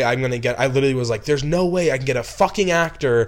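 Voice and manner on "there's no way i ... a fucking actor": loud, high, furious yell into the phone; angry director screaming at his producer on the phone; retold scream at producer. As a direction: shout it, fed up with impossible demands. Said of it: "I screamed on the phone".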